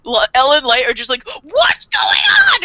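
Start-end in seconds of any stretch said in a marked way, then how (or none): 1.4-2.6 high, loud drawn-out cry; comic high-pitched panicked shout; mock freak-out. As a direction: wail it in hammed-up panic. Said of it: "everyone just starts freaking out".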